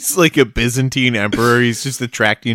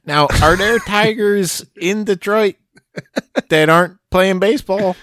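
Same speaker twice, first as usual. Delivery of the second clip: clueless tourist; 6 semitones higher than usual. Pretend. Mocking clueless tourist question.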